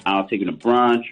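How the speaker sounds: usual speaking voice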